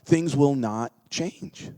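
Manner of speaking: usual speaking voice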